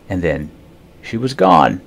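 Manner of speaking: usual speaking voice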